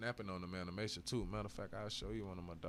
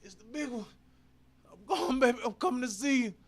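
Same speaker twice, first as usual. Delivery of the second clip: much higher, loud hammed-up heart attack cry; mock dying old-timer; 14 semitones higher, 11 dB louder than usual. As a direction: ham up an old man clutching his chest. Mimics OG dying theatrically.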